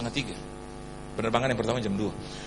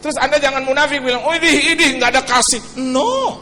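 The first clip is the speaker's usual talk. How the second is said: louder preaching to the congregation; forceful preaching; 6 semitones higher, 5 dB louder than usual. Acted. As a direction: push it out over the crowd. (khotbah lantang kepada jemaat)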